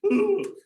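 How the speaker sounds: high, loud mock wail of "ooh"; panicky "ooh". Boy afraid of being caught, hammed-up panicky ooh. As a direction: let out a high, comic panicked hoot. Panicking boy's noise in the story.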